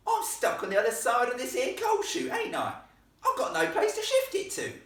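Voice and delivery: very high, loud exasperated complaint; aggrieved Cockney lad yelling; rough-accented boy ghost. Acted. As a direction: shout back indignantly in a rough accent. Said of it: "the ghost's rough accent".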